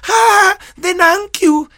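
Gina Montes catchphrase. Retold or imitated. Teacher mimics a pretty TV star's exit line, high, loud and drawn out. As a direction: stretch it out high and loud like a glamorous TV beauty waving goodbye. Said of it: "nada más decía".

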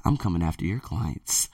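low, quiet menace; quiet threat. Real, not acted. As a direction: drop the voice to a threat.

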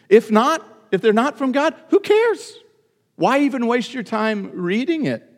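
high, loud incredulous challenge; rhetorical outburst. Real, not acted. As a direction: voice leaping up to a peak on "who cares". Preacher's rhetorical outburst dismissing Scripture if not God's.

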